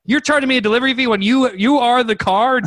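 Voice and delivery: high-pitched indignant tirade; freaking out. Retold or imitated. Buyer objects to delivery fee, freaking out. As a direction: rush in, then stretch out the obvious in disbelief. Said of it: "I'm freaking out".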